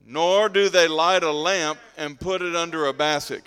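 loud congregational reading; scripture read aloud. Acted. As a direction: lead the verse out loud in measured phrases.

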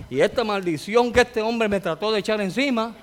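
normal talking voice

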